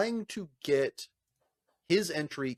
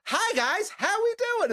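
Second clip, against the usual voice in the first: much higher, bright sing-song greeting; chipper morning bestie; 4 dB louder, slower than usual. Retold or imitated. Coworker greets stressed judges in high cheery voice. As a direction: breeze in like the office sunshine.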